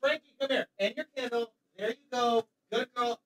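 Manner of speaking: high, soft voice away from the microphone; distant pet talk; off-mic dog coaxing. Real, not acted. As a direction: coax the dog from across the room. Steps away to kennel the dog.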